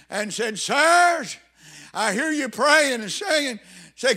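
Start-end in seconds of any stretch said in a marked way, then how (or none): 0.6-3.6 high, loud call of a pleading prisoner; crying out. Retold or imitated. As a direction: cry it out across the prison. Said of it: "somewhere cried out".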